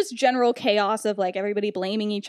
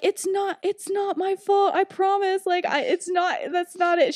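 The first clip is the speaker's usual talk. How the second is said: whiny protest; 8 semitones higher than usual. Retold or imitated. James pleading innocence.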